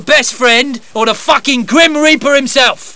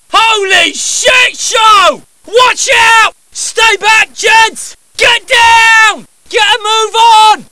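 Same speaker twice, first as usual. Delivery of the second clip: frantic yelled combat orders, higher and louder; battle shouting; 13 semitones higher, 7 dB louder, much slower than usual. Acted. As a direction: bellow over gunfire. Combat barks under fire.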